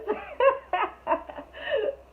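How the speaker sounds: high squealing laugh that breaks up; shrieking laugh. Real, not acted. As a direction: burst out in a shrill disbelieving laugh. Retelling the shocking confession.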